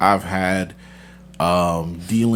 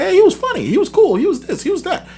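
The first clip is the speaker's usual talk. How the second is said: high-pitched imitation of other people; mock chorus of approval; 3 dB louder, faster than usual. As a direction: voice them in a squeaky-high quote, half laughing. Voices people who change their minds.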